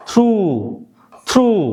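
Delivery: call-and-response through; drill pronunciation. Shown as a demonstration. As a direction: lead the class saying through. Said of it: "সকলে একটু আমার সাথে উচ্চারণ করুন".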